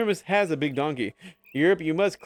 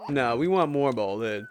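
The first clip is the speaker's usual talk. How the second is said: ordinary delivery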